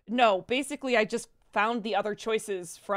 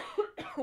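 sharp choking cough; coughing on water; 10 semitones higher, 6 dB quieter than usual. Real, not acted. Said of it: "Water went down the wrong pipe".

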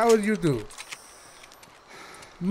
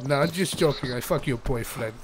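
usual speaking voice

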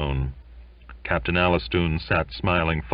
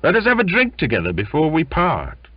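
eager invitation; 11 semitones higher, 5 dB louder, faster than usual. Acted. Sailors urge a parting drink.

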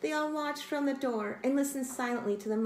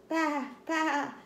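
higher baa-ing; sheep baa; 6 semitones higher than usual. Acted. Sheep answers kitten with a bleat. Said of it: "said Sheep".

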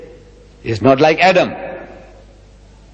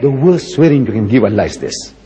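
usual speaking voice

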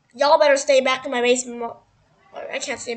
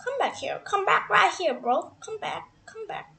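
ordinary talk, nothing marked

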